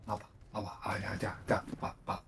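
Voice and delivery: hushed coo for the cat. Real, not acted. Cooing to the cat.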